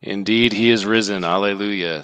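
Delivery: ordinary talk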